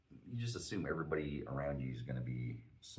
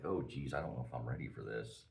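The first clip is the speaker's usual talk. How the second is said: low, soft muttered worry; retold inner voice, sinking low; nervous aside; 4 semitones lower, 6 dB quieter than usual. Facing an aggressive stranger at the urinal, uneasy.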